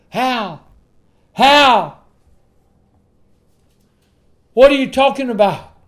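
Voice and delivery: high, loud pulpit outburst; preacher's exasperated, challenging yell; incredulous shout. Acted. Acts out challenge to professed believer.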